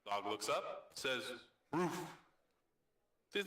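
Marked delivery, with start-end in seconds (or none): 1.7-2.0 word voiced as a bark; dog's bark. Talking dog answers its owner, barking the word. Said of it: "an answer that sounds like a bark".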